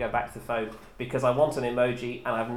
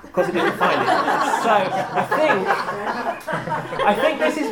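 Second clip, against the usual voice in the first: laughing; 8 semitones higher, 6 dB louder, much slower than usual. Real, not acted. Laughing at the failed search.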